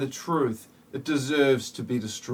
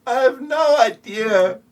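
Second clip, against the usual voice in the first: high, louder clueless answer; mock party guest; 9 semitones higher, 7 dB louder than usual. A guest answering the other guest, high and louder.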